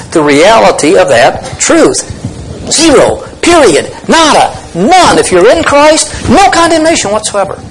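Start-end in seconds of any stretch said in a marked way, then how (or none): 2.7-7.6 ZERO, PERIOD, NADA, NONE each punched high, climbing to WHATSOEVER; emphatic stress. Shown as a demonstration. Preacher stressing the reality of that truth, emphatic ZERO and NONE. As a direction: hammer each negation higher, up to NO CONDEMNATION WHATSOEVER. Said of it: "really trying to stress".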